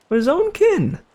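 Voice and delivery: high mock-dramatic echo; dramatic repeat.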